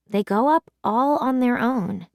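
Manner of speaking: plain reading voice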